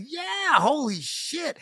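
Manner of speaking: sudden high yelp of joy; can't believe the good news, high and bursting; squealing yeah, holy shit; excited. Retold or imitated. Excited phone reply in story.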